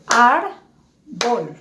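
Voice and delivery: "árbol" in two slow beats, ár... bol; syllable by syllable. Shown as a demonstration. Teacher shows how to pronounce by syllables, syllable by syllable. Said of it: "voy a pronunciar por sílabas".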